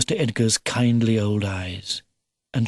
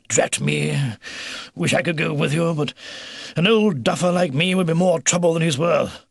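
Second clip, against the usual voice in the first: put-on raised, blustery old duffer voice; old beaver, regretful; 4 semitones higher than usual. Old beaver wishing he could join them, blustery. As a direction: grumble at yourself, then send them off.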